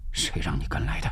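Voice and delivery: low, hushed reproach. A narrator's reading. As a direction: drop the voice low and soft. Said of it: "吴石压低声音说".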